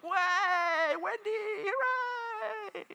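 very high, drawn-out mock cheer; cheering studio audience. Audience greets Wendy as she comes out, mock cheering. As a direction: squeal like an overexcited crowd. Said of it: "everybody goes".

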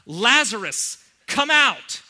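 loud, high, ringing call to the dead; commanding shout. Acted. Jesus calls Lazarus out of the tomb, commanding shout. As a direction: bellow it into the tomb. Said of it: "he calls out".

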